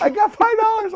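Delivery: very high, loud hollered offer; Kevin. Retold or imitated. Storyteller voicing his friend waving five dollars, pestering.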